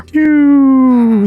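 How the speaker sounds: high, loud sigh of relief; loud relieved phew. Real, not acted. Gamer relieved the villager finally moved, loud high phew. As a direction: blow out the tension all at once.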